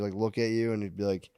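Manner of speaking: ordinary talk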